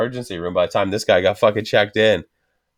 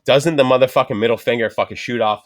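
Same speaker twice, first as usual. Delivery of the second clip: ordinary talk, nothing marked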